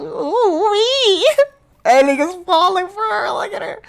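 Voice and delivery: high squeaky teasing voice; mocking falsetto tease. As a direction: squeal it like a gossiping girl. Teasing reaction voice.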